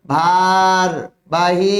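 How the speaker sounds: ভারবাহী drawn out very slowly with a rise at the end; teacher holding ভারবাহী long for students; slow spelling demo. Shown as a demonstration. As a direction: stretch ভারবাহী syllable by syllable. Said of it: "তারপরে ভারবাহী".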